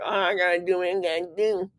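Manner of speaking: a lightly put-on silly voice for a grudging chore line; mock-whiny, not taking himself serious; funny voice. Talking to his viewers, a light funny voice. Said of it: "say it in a funny voice".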